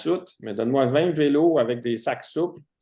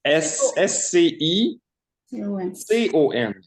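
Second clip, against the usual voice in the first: slow, higher, letter by letter; spelling it out; 7 semitones higher, 4 dB louder, much slower than usual. Real, not acted. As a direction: dictate each letter loud and clear. Spelling out a brand name. (épellation lente, lettre par lettre)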